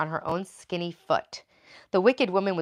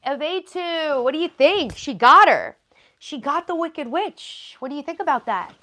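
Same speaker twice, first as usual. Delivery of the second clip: pet talk; 9 semitones higher, 7 dB louder than usual. Real, not acted. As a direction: coo at the pet. Addressing pet excitedly.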